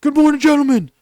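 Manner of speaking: high, loud recruit bark; barked sir yes sir. Retold or imitated. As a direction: scream it at full force like a recruit. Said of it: "recruit greeting in boot camp".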